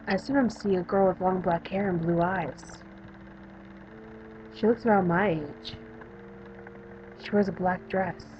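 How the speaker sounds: subdued, lightly acted patient character; a hint of a withdrawn young patient; lightly voiced young patient. Patient describing the girl she sees, subdued character voice.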